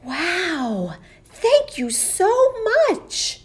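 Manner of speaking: high, loud delighted thanks; grateful knight. Acted. Knight thanks the ogre's rescuer, high and delighted. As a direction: gush with relief.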